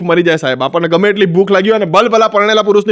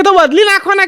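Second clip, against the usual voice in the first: much higher, bossy lady voice; lady customer; 10 semitones higher than usual. Retold or imitated. Woman telling the mechanic to just replace it, high and bossy. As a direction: brush the mechanic off like a fussy lady.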